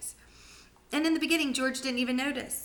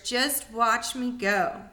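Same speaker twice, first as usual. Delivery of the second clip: put-on boastful old grandma; much slower than usual. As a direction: drag out every word, gloating.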